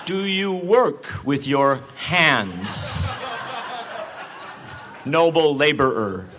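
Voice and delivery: mock condescending reporter, loud and slow; hammed-up patronizing reporter addressing a laborer. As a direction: talk down to a worker, loud and very slow. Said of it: "speak in a loud, slow voice".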